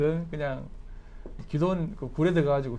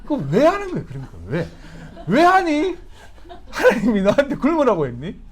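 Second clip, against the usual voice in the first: high, exasperated why-on-earth scolding; hammed-up indignation for a laugh; mock angry questioning; 7 semitones higher, 4 dB louder than usual. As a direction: demand an explanation in comic outrage. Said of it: "막 화를 내는 거예요".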